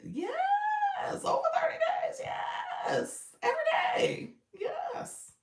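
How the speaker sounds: shrieking, laughing hype of every single day; excited shouting. Real, not acted. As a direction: cheer the thirty days like a win. Hyping thirty days of consistency.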